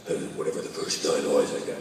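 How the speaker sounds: low, unvoiced gravel growl; Batman. Actor retelling Batman's odd voice in the film, gravelly growl. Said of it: "Why is he talking like that".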